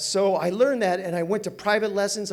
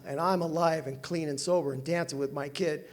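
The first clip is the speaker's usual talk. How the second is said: usual speaking voice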